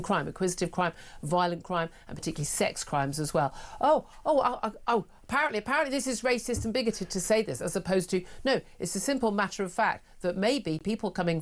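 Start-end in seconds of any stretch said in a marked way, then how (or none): 3.8-7.6 higher-pitched mock scandalised objection; mock outrage. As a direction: parody shocked critics, pitched up on the ohs. Mimicking critics who call it racist.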